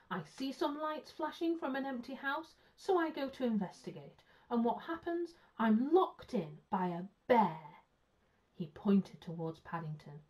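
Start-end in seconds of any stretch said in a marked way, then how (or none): 0.1-7.8 aggrieved policeman, pitched up; bitter indignation. A narrator's reading. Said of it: "he exclaimed bitterly".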